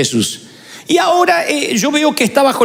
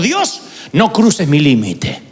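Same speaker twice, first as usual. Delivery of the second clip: slow, lower, weighty; solemn title line; 5 semitones lower, slower than usual. Shown as a demonstration. Preacher announcing his sermon title, slow and solemn. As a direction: lay the sermon title down slowly. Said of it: "Así titulé el sermón de hoy".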